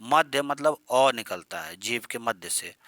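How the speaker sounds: ordinary talk, nothing marked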